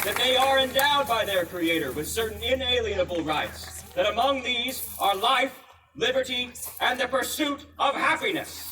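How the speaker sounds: stirring oration building to a cry; rousing declamation. Acted. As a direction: proclaim it to the back row. Rousing recital of famous rights.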